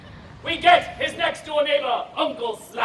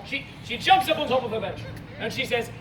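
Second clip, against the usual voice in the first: ordinary talk, nothing marked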